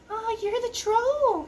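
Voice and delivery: high, slow little goat voice. Acted. Little goat meeting the troll, high and wary. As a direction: sound like a small wary goat. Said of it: "he said".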